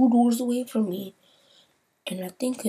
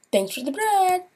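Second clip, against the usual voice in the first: high, meek voice thanking him; a small awkward high thank-you; 10 semitones higher than usual. Retold or imitated. Quickly thanking the bread guy before leaving, high and meek. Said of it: "I'm like".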